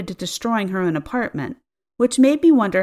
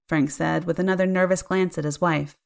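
ordinary delivery, nothing marked

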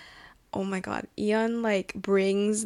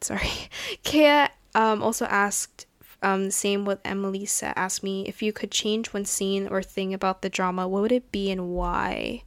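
ordinary delivery

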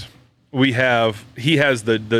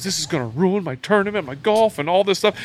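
higher-pitched mock panic of a son; imitated freaking out; 7 semitones higher than usual. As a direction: retell a kid's worked-up complaint, pitch raised. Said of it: "he's, like, freaking out".

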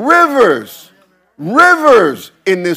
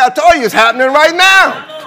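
higher, louder retort; emphatic reply; 7 semitones higher, 4 dB louder than usual. Retold or imitated. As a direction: answer them with force. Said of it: "I said".

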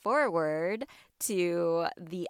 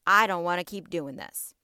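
frazzled exasperation; 10 dB louder than usual. Pretend. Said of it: "we're like".

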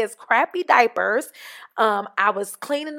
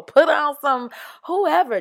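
"whoever" called out higher and louder; excited; 7 semitones higher, 6 dB louder than usual. Real, not acted. Urging listeners to dance while cleaning, "whoever" loud and high. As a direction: hype it up like a party starter. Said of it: "Get geeked up about cleaning up".

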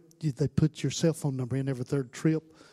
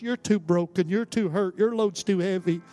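raised, insistent preacher's voice; fervent altar-call preaching; 8 semitones higher, 9 dB louder than usual. Real, not acted. Preacher calling hurting people to the altar, fervent preaching. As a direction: press each line on the congregation with steady fervor.

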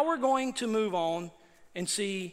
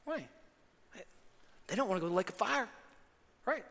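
usual speaking voice

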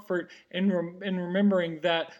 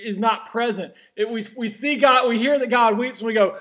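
ordinary talk

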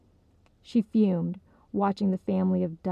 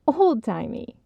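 mock repeat of "Old-timey", louder; scoffing; 4 semitones higher, 5 dB louder than usual. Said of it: "Esther scoffed".

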